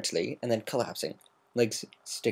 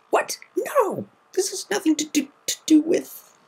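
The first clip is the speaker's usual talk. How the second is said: very high, panicked stammer; flustered high-pitched denial; 4 dB louder than usual. A narrator's reading. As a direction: deny it in a rush of panic. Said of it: "she stammered".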